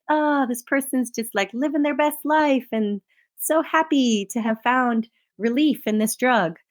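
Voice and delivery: mock the happy pharmaceutical ad; gushing drug commercial. Podcast host parodying a drug commercial's happy patient, gushing. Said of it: "the commercial is just like".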